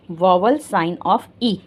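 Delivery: slow dictation. Shown as a demonstration. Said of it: "teacher dictating the term".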